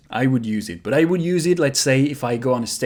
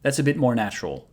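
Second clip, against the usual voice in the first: ordinary talk, nothing marked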